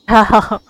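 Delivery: a quick burst of laughter; amused little laugh; short laugh. Real, not acted. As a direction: laugh out loud at it. Streamer laughs at the game.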